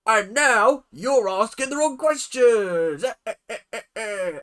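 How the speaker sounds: exaggerated British character voice; mock British accent. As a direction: ham up a pipe-smoking Brit. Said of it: "get my little mouth pipe out".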